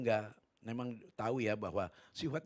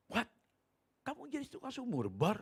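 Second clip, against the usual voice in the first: high disbelieving what; shocked; 12 semitones higher than usual. Retold or imitated. Preacher asks his old schoolmate, disbelieving and high. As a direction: pitch jumps up in astonishment.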